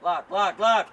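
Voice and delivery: loud repeated name call. Real, not acted. Coach calling Vlad on the pitch, loud shout. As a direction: shout to get the player's attention.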